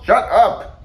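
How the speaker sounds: high, loud outburst; screaming. A narrator's reading. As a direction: scream to cut off the announcer. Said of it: "screamed Toad".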